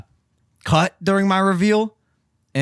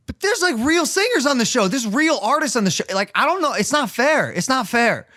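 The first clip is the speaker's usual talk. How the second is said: mock whine; 9 semitones higher than usual. Real, not acted. Complaining about real singers.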